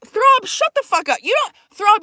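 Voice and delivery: high, indignant telling-off; scolding. Real, not acted. Storyteller tells Throb to shut up, indignant scolding. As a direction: shut down the interrupter, pitch jumping up.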